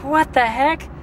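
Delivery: high incredulous what the heck; playfully baffled, voice jumping up; retold shock at the empty shop; mock disbelief. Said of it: "So I'm like".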